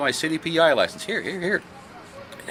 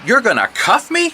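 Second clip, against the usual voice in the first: high, loud, disbelieving objection; exasperated protest; 10 semitones higher, 4 dB louder than usual. Acted. Detective protesting to the cop, loud and outraged. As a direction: fight back against the cuffs, outraged.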